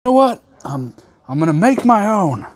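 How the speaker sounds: high defiant "you know what"; defiant self-quote. Retold or imitated. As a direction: announce it like a challenge. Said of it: "So I'm like".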